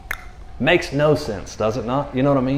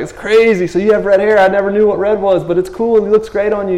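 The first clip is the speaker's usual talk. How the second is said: high, louder, wonder-struck chatter; amazed newly-sighted man; 9 semitones higher, 5 dB louder than usual. Retold or imitated. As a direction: marvel at everything like seeing it first. Healed man marvelling at colors.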